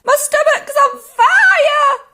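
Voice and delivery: high, loud wail, stretched out; sudden shout. A narrator's reading. Grandma with a burning stomach, shrieking alarm. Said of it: "she shouted suddenly".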